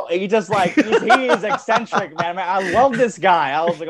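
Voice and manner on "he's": ordinary talk, nothing marked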